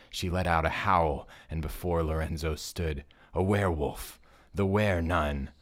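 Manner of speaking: loud frightened scream; girl screaming. Acted. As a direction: shriek it out in terror. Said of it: "one of the smaller girls screamed".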